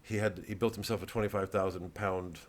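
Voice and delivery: ordinary talk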